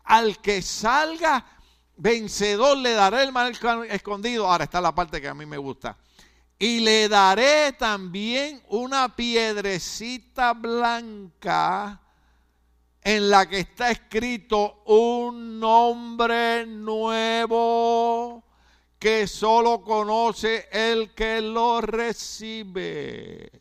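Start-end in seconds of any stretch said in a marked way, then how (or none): 0.0-4.5 solemn scripture reading. Acted. Reads scripture aloud. 6.6-23.5 slow, louder, higher proclamation of the verse; solemn scripture reading. Acted. Preacher proclaiming the promise to the congregation, slow and solemn. Reads his favourite verse.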